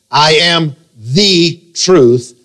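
"the" drawn out as "thee" and set apart; very slow, punch the word "the" before "truth"; stress on THE. Shown as a demonstration. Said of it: "He did not say, I am a way".

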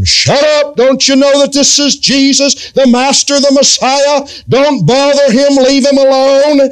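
hushing the blind man sharply; crowd scolding. Retold or imitated. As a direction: shut him down like an irritated crowd. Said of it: "they would tell him".

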